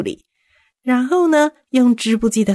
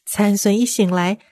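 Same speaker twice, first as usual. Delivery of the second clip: ordinary delivery, nothing marked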